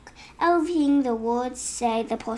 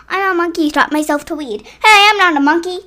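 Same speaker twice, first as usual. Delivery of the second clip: indignant; 4 semitones higher, 9 dB louder, faster than usual. Acted. Reading the silly book aloud.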